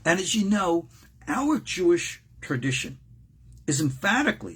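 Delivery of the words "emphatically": "emphatically" said with deliberate emphasis; stress on emphatically. Real, not acted. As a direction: lean on the word "emphatically". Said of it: "I emphasize that word emphatically".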